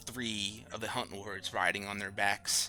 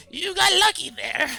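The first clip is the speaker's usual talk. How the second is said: much higher, louder chuckling character voice; high amused NPC; 11 dB louder than usual. Acted. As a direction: tease the party through laughter. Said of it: "And Avgar goes".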